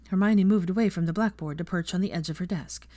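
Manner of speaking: ordinary delivery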